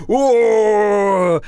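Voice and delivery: cartoonish death yelp; dying whoa. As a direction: ham up the monster's final howl as it falls. Enemy collapses with a cry.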